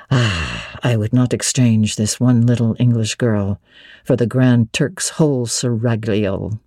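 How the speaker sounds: low and relishing every word; Rochester, delighted. A narrator's reading. Rochester delighting in Jane's spirited reply, low voice.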